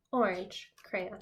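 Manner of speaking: usual speaking voice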